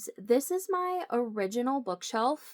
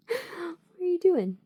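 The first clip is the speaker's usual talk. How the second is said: higher, slow coo at the cat; 8 semitones higher, slower than usual. Real, not acted. Talking to cat.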